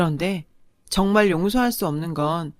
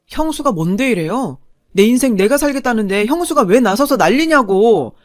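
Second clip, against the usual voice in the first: loud, high, bristling defiance at his sister-in-law; furious shouting; 3 semitones higher, 7 dB louder than usual. Retold or imitated. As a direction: stand up for yourself, voice at full pitch. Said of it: "절 향해 핏대를 세우더라고요".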